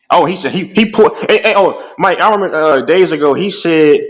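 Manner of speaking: normal talking voice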